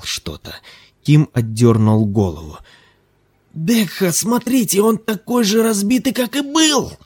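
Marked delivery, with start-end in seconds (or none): 3.5-6.9 excited. A narrator's reading. Kim insists, pulling away.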